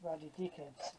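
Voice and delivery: Ruddy dickheads said low and soft under the breath; muttered grumble. Real, not acted. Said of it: "that's what I say".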